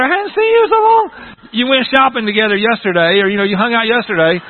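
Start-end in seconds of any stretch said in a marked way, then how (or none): 0.0-1.0 high, loud gushing squeal; hammed-up excited falsetto; ladies' greeting squeal. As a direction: gush shrieking over a long-missed friend. Imitates ladies greeting with a hug.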